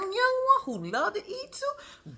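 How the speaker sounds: put-on sassy aside about a hungry kid; drawn-out teasing emphasis. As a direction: stretch it out for effect.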